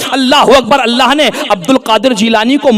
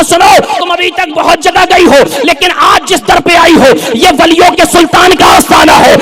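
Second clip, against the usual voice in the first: full-force preaching; 6 semitones higher than usual. Acted. Preacher rousing the crowd about the saint's shrine, full force.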